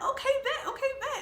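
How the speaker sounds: high eager okay; chirpy delighted agreement; excited. Retold or imitated. Excited reply.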